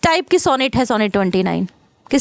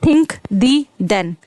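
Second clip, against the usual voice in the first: "think the then" very slowly, word by word; alliteration demo; much slower than usual. Shown as a demonstration. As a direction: set apart each word of "think the then" to show the repeated initial sound. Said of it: "द, द, द का आ रहा है".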